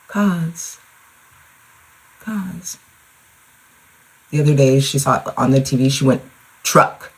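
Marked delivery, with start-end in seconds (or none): 0.1-2.8 high, soft imitation of a little daughter; imitating toddler. Mom mimics her daughter who loves cars, high soft toddler voice. 6.6-7.1 imitating a child's firmly enunciated "Truck"; firm enunciation. Mom mimics her daughter naming what's on TV, "Truck" firmly enunciated. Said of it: "she very firmly enunciates her words".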